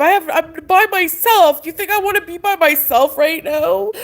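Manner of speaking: tearful, pleading question; crying as she asks it; real crying. Real, not acted. Upset, alone on a livestream.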